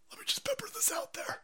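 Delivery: high, breathy whispered repeat. Pretend. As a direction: whisper it like a secret.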